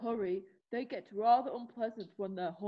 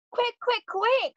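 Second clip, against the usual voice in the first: high, loud panicked repeats; urgent shouting; 12 semitones higher, 9 dB louder than usual. Acted. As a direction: push them on, loud and frantic. Fleeing pursuers, urging speed.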